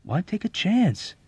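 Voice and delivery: slow, lilting appeal; reasoning. A narrator's reading. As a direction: reason with him patiently. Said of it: "reasoned with him in a low voice".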